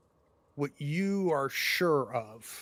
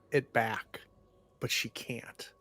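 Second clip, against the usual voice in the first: usual speaking voice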